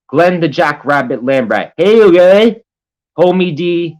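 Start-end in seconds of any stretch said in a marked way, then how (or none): 1.8-2.6 much higher and louder mock greeting; mock chat voice. Streamer reading Glenn's message in a squeaky louder mock voice. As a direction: toss it off in a silly squeaky voice.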